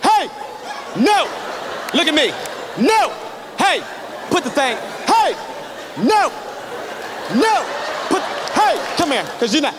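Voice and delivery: high, sharp bursts with pauses between; exasperated dad scolding. Acted. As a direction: scold unruly kids, losing patience as you go. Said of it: "I really get upset".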